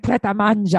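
Pret a Manger with an Italian flourish; imitated Italian accent. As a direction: put on her Italian accent, "pret-ah-MAHN-zha". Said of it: "that's an Italian accent for sure".

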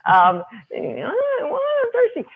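put-on very high whiny little kid; child's whine. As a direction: whimper it like a thirsty toddler. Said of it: "I have a whining child".

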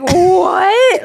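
high rising, astonished what; mock disbelief. Retelling the family's reaction to mom, high incredulous cry. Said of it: "we're all just like".

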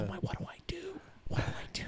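frantic whispered self-talk; mock panicked whisper. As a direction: whisper as if secretly at a total loss. Acts out panicked inner thoughts.

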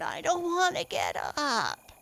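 drowsy big brother turtle; sleepy turtle murmur. A narrator's reading. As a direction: grumble without waking. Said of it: "he murmured".

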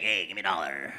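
low, lazy mooching voice; a hint of a panhandler asking; homeless guy. Homeless guy at a cafe asks comedian for money, lightly voiced low. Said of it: "He's like".

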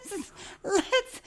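high breathy laugh words; giggling. Real, not acted. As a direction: laugh it out softly. Laughing after joke.

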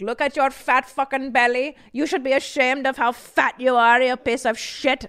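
a mock yell at a student, half-hearted; imitating yelling. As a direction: bark it like a cruel instructor. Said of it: "he would yell at me".

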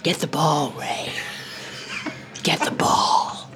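soft, slow, barely-there whisper; deadpan, whispered, unbothered; cool low murmur. Retold or imitated. Jack Nicholson coolly urging his son, slow and whispered. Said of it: "he'd just go".

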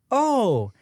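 put-on high, sweet kids'-show host; Miss Rachel. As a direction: talk to toddlers in a cute, pure high voice. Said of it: "super cute, pure voice".

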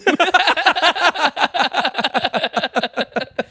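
loud burst of high laughter. Real, not acted. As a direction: let out a big cackling laugh.

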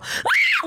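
short, very high reenacted shriek; high mock scream of fright; startled scream. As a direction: yelp as if caught hiding. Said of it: "Like, I just scream".

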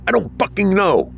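high-pitched frustrated outburst; exasperated. A narrator's reading. Samson searching the attic for anything, exasperated. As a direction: let frustration spike the pitch, not the volume.

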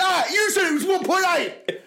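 high, loud mock outrage; panicked accusation. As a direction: imitate a mate yelling in panic. Retelling friend's panicked accusation.

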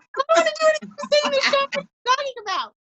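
high, fast, frantic protest at her husband. Retold or imitated. Mother in labour refusing her husband's request to leave the shower, frantic and high-pitched. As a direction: squeal it like nothing else matters but the shower.

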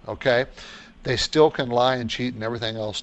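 ordinary delivery

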